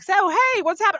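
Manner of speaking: mock friendly nudge, pitched well up; imitating the host. Guest imitates the host nudging her to join, pitched high. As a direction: lightly play the host checking in, high. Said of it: "you're like".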